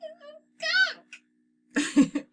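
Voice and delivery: very high, slow stuffed-up voice; family member blocked-nose from the smell; held-nose voice. A narrator's reading. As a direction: speak like you have the worst cold. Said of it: "sounded as if they had the worst colds".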